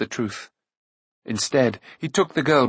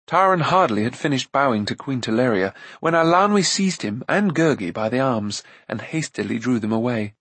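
usual speaking voice

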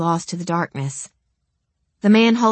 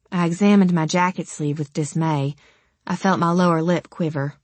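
usual speaking voice

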